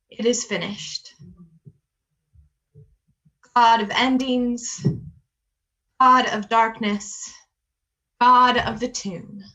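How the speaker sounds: solemn prayer, leaning on each repeated word; closing prayer. Acted. Minister closing the Good Friday worship in prayer.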